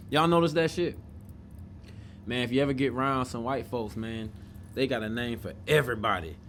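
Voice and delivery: usual speaking voice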